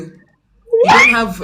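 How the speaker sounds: very high, slow, drawn-out cry; host stunned the candidate has nothing, very high; exasperated high exclaim. Real, not acted. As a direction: stretch it out high in disbelief.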